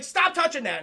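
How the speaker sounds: loud, high scolding shout; sharp order. Retold or imitated. Soldier catches a woman touching the strange blood, sharp order.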